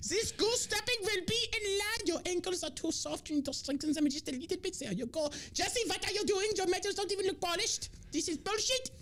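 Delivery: very high camp German drill officer; mock German accent. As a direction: scold the troops in a shrieky camp German voice. Plays camp German officer inspecting troops.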